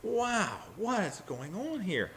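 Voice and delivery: high, hushed astonishment; amazed. Acted. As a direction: gasp it out in wonder. Voicing the brothers' astonishment.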